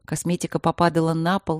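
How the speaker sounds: usual speaking voice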